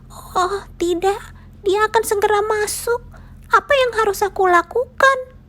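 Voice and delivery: a tiny mouse hearing the lion coming; panicked little mouse. A narrator's reading. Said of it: "Si tikus gemetar". (tikus panik dengan suara tinggi melengking)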